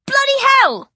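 sudden high startled yell. Acted. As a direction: yell it out in fright. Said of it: "I yell".

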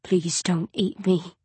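slow, soft miserable plea; low miserable voice. Acted. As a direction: beg quietly, defeated. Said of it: "in a low, miserable voice".

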